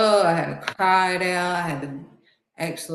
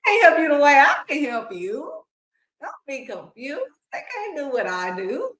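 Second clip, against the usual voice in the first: higher, emotional, softening toward the end; God's voice; 8 semitones higher, 4 dB louder than usual. Retold or imitated. God reassuring her, raised emotional voice. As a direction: plead as God, then ease off. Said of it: "God's like".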